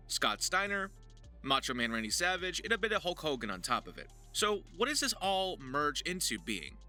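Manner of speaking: ordinary talk, nothing marked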